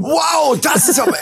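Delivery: high excited wow; surprised wow. Real, not acted. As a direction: go way up in delight. Excited at merch idea. (begeistertes Staunen)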